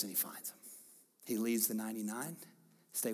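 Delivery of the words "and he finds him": whispered, drawing the room in close; hushed whisper. Acted. Pastor to congregation, on Jesus finding him, whispered. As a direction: breathe it, almost no voice.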